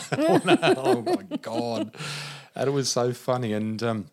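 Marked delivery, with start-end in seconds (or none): 0.0-1.9 loud high laugh. Real, not acted. Teller laughing at the healer's excuse, loud and high. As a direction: let the laugh take over. Laughing after the quoted line.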